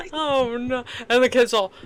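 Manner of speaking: normal talking voice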